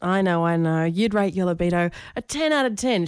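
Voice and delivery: ordinary talk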